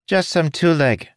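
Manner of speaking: high squeaky creature; a high little critter voice; small creature voice. A narrator's reading. Finch creature voice.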